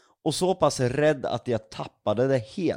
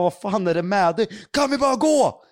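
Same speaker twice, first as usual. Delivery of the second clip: panicked plea; 7 semitones higher, 4 dB louder than usual. Retold or imitated. Panic retold in the forest.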